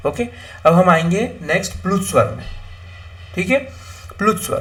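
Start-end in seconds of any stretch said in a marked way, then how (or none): none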